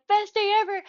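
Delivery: squealing Best day ever; overjoyed exclamation. Retold or imitated. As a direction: burst out with pure joy. Overjoyed getting the candy.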